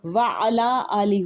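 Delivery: "وعلا آل" said slowly with the "عَلا" clearly separated before "آل"; careful "وعلا آل", the ain of "عَلا" clearly sounded; correct pronunciation. Shown as a demonstration. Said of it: "ولا آل نہیں ہوتا".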